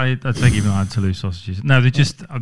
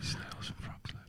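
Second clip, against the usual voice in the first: whisper; 21 dB quieter, slower than usual. Real, not acted. Repeating the chart item.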